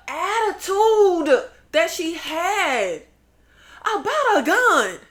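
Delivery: slow, high mock wail; hammed-up crying. Real, not acted. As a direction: whine it out in mock tears. Fake crying outrage.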